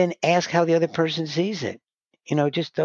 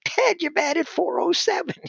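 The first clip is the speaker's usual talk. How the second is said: put-on high incredulous kid voice, slow; squeaky disbelieving youngster talking back to Ted Williams; bewildered kid. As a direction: play the baffled kid protesting to his hero. Kid answers baseball legend, bewildered.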